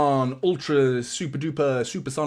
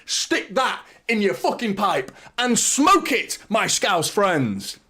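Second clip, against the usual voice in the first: elated goal replay; 7 semitones higher, 4 dB louder than usual. Real, not acted. City fan gushing over the goal to his viewers, real elation. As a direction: still riding the high of the goal, savour every word.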